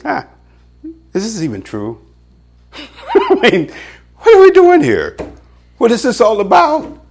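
high, laughing mock doubt; mock doubter. Believer doubting Scripture during sermon, mock scoffing.

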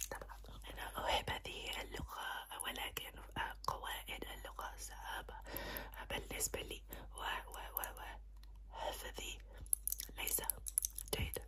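very soft, unvoiced Arabic whisper. Shown as a demonstration. Doctor testing patient's hearing, whispered Arabic. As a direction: whisper Arabic close to the ear. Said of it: "whisper some languages in your ear".

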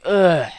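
loud, high "ugh"; disgusted groan. Acted. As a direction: groan out your revulsion loudly. Acts out disgust at his thoughts.

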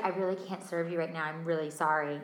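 a hint of a smug, smiling refusal; smug flight attendant. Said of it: "she's literally smiling as she's saying this".

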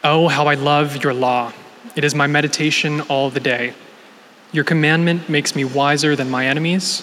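ordinary talk

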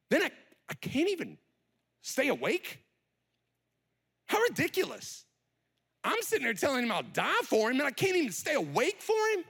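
high-pitched, incredulous self-reproach; exasperated disbelief. Acted. Peter scolding himself for failing to stay awake, incredulous. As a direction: question yourself in rising disbelief.

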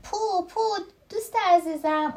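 story shout. A narrator's reading. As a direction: call out to a dear friend. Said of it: "خب کوچولو داد زد".

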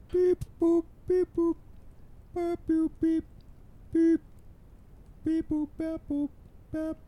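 very high squeaky beep-boop noises; robot beeps. Pretend. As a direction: chirp like a toy robot. Alone on mic, playing with gear.

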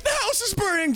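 high-pitched mock mom voice; panicked mom. Mom reporting a house fire.

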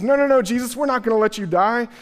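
high, loud no no no pleading with Jesus; alarmed protest. Acted. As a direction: jump in to stop him, alarmed. Peter protests Jesus' death.

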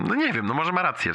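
usual speaking voice

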